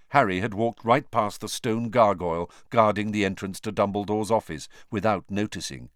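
ordinary delivery

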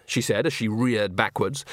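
ordinary delivery

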